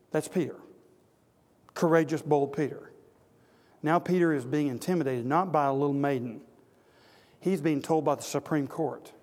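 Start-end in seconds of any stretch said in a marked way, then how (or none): none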